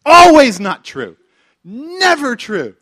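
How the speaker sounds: loud, slow, hammered-out rebuttal; fiery preacher refusal; emphatic denial. Real, not acted. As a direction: pound every word. Preacher rejecting a fatalist saying.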